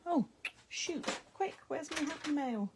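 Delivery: ordinary delivery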